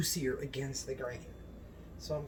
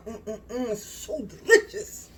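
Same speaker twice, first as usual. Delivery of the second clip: ecstatic relish; 5 semitones higher than usual. Real, not acted. Cook raving to viewers at the first bites, ecstatic relish. Raves while tasting the beef.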